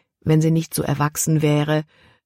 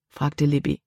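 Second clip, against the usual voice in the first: plain reading voice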